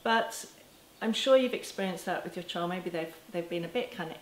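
ordinary delivery

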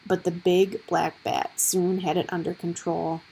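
ordinary delivery, nothing marked

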